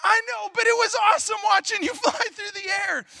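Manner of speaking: can't stop laughing while apologizing; big brother answering his angry little brother, laughing loudly. Retold or imitated.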